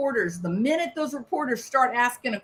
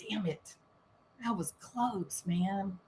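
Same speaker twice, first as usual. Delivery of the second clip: much softer, relieved mutter; muttering to himself; 4 semitones lower, 12 dB quieter than usual. Retold or imitated. Cheating spouse driving off alone, quiet relieved mutter. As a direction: mutter it under your breath in the car. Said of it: "driving off down the road going".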